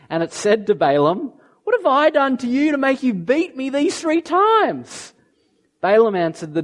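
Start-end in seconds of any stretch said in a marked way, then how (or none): 1.6-5.1 high, odd-sounding donkey voice; aggrieved donkey asking why it was beaten; talking donkey. Acted. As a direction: pitch it up like a wronged talking donkey. Donkey speaks while reading scripture.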